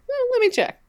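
squeaky high mock mutter; Eh, let me check in a jokey high voice; silly high put-on voice. Shown as a demonstration. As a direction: play it as a goofy high-voiced aside.

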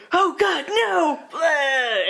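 high, loud shriek of horror; horrified cry. Retold or imitated. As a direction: scream as if seeing a monster. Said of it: "he's like".

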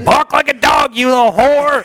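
high, hammed-up commanding voice; mock command. Acting out the order the fan begged for, mock command.

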